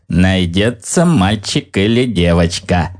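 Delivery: ordinary delivery, nothing marked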